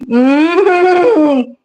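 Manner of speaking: hammed-up crying plea; mock crying. Pleading with Julio not to take her Coca-Cola, fake sobbing. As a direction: fake whimpering sob.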